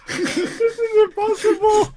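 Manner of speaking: high laughing wail; laughing despair. Real, not acted. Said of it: "frustrated at the game".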